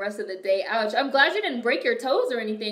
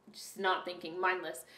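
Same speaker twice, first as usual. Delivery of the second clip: "Just not thinking" dropped much softer and low; quiet repeat; 3 semitones lower, 8 dB quieter than usual. Real, not acted.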